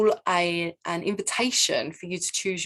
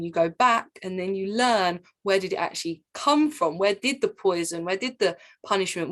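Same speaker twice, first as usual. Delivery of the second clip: usual speaking voice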